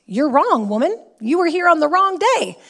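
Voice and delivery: high, loud mock telling-off; indignant retort. Studio owner imagines correcting the irate woman, indignant. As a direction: act out a forceful, indignant correction.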